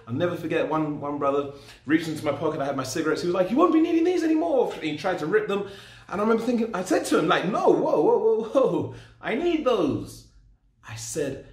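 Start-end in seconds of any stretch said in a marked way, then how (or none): 3.5-4.7 playful confiscation. Retold or imitated. Brother grabs his cigarettes. 7.5-10.3 alarmed whoa. Retold or imitated. Stops brother ripping cigarettes.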